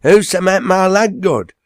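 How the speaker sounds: loud, high retort; indignant shout. Acted. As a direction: yell it back over the hedge. Enoch retorting from behind hedge.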